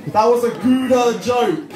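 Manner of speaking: comic outburst; mock protest. Real, not acted. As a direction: defend the joke in hammed-up indignation. Protests after crowd groans at pun.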